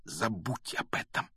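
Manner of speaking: soft, hushed interruption; near-soundless whisper. A narrator's reading. Harry cutting short his friend's celebration, almost whispering. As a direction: drop to a secretive hush. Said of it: "почти беззвучно прошептал Гарри".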